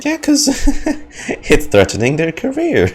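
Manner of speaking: says it through a laugh, amused; chuckling at the absurd situation. Real, not acted. Laughing at game creatures' plight.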